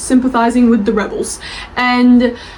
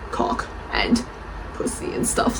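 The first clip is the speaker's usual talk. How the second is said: whispered rude words; 5 semitones lower, 11 dB quieter than usual. Real, not acted. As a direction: drop to a whisper for the naughty words. Saying rude words on camera.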